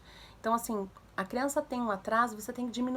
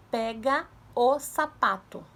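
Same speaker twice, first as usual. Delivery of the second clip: very slow, louder, word by word; exaggerated clear articulation; 6 dB louder, much slower than usual. Shown as a demonstration. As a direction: model each word for a child to imitate. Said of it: "A gente fica parecendo meio doida depois falando isso". (devagar e bem articulado, para criança imitar)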